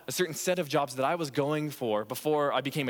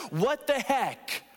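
drawn-out mock disbelief; 7 semitones higher, much slower than usual. Frustrated reaction at closed door.

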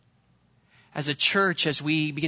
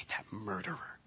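whispered, much softer horrified verdict; shocked whisper; 6 semitones lower, 16 dB quieter than usual. Retold or imitated. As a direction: breathe it out in disgust at the news. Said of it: "and you just say".

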